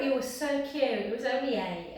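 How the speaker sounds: usual speaking voice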